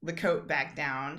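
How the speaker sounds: normal talking voice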